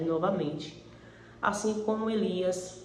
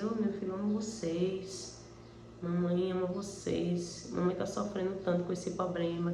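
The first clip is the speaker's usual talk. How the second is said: tender, soft to son; 8 dB quieter than usual. Retold or imitated. Mother telling her son she loves him, soft and tender. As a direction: hush it down, like comforting her child.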